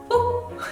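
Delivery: high playful whoop sound. Real, not acted. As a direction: yelp a short high whoop. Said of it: "please excuse the whoop".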